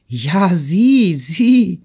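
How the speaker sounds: high, swooping cry of delight. A narrator's reading. Natalie cries out to Peter in admiration, delighted. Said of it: "rief sie entzückt".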